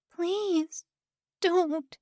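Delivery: raspy, high, pleading croak. Acted. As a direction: beg through a sore throat. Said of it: "she croaked".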